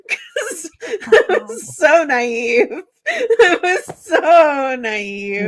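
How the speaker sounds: slow words broken by laughter; laughing. Real, not acted. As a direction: laugh through every word. Laughing at her own naivety.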